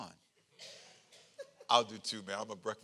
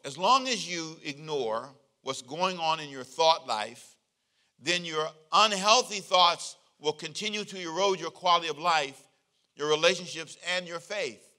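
slow, louder quoted reading; reading a quote; 2 semitones higher, 6 dB louder than usual. Acted. Pastor reading a favorite book quote to the church, slow and weighty. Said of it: "I love this quote".

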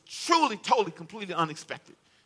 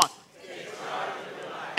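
whispered repeat; 9 dB quieter, much slower than usual. Shown as a demonstration. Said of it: "Can you say it with me".

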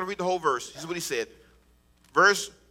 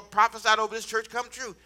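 ordinary talk

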